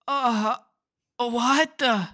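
hammed-up foreign accent in mock shock; silly European accent, loudly baffled; put-on European accent. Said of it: "that accent you were doing".

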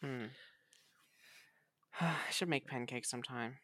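ordinary delivery

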